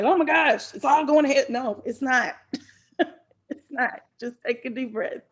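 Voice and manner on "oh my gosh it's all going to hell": exaggerated panic, higher and louder; mock freak-out. Coach imitating someone who freaks out instead of fixing it, mock panic. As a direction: play up the overreaction. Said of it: "freaking out and being like".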